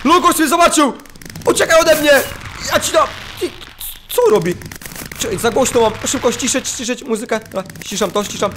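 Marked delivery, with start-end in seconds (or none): 0.1-2.2 high, loud, thrilled yelling as fireworks go off; excited shouting. Real, not acted. Streamer yelling with glee at his fireworks. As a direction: cheer the explosion on at full volume.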